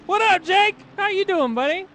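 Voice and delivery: very high, loud hail to a buddy; excited greeting. Real, not acted. Host spots Jake in chat, excited greeting. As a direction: call out delightedly, pitch way up.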